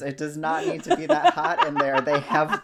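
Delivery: real laughter while venting about hot greenhouses; laughing while ranting. Real, not acted. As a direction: laugh through the complaint about windows. Laughing through a mock rant.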